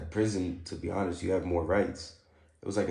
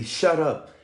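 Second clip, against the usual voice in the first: translated order; 5 semitones higher, 6 dB louder than usual. Retold or imitated. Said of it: "So basically".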